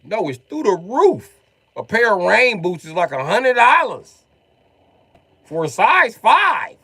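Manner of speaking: high, loud, drawn-out price complaint; outraged rant. Acted. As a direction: stretch each phrase out in loud, high disbelief. Comic rant about boot prices.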